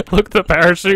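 amused. Real, not acted. Laughing at a parachute skin.

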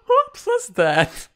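slow, very high, laughing disbelief; incredulous laugh. Real, not acted. Asking what just happened, high and laughing. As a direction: stretch it out in helpless laughter. Reacting to bizarre cartoon scene.

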